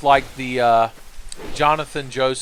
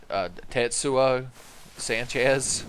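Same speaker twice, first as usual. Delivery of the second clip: ordinary delivery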